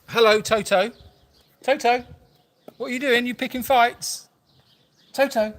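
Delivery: high sing-out pet-talk; calling a pet. Real, not acted. Host asks Toto about picking fights, high-pitched pet-calling.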